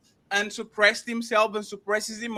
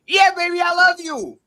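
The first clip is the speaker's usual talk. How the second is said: higher and louder, sugary mock lover's voice; mock doting girlfriend; 7 semitones higher, 7 dB louder than usual. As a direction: play the girlfriend who'd sleep on barbed wire. Mocking a devoted girlfriend.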